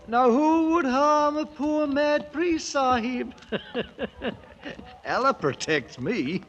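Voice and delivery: high, slow put-on holy man voice; a lightly wheedling beggar-priest voice; mock mad priest. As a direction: play the harmless mad priest.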